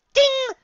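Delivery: high, bright ding; bell imitation. A narrator's reading. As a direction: chime it out. Narrator's game-show bell.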